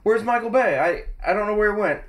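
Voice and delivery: high, puzzled mock voice; high mock confusion. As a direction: ask around in a high puzzled voice. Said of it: "He's gonna be like".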